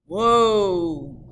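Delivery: loud call to the horses; coachman calling. Acted. Coachman to his horses, a loud whoa. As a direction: haul the team up with a shout. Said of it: "said the coachman".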